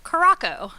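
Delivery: Caraco, with a k sound for the ç; bar mispronunciation. Shown as a demonstration. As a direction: say Caraco as mangled at bars, "kuh-RAH-ko". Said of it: "How it gets pronounced at bars".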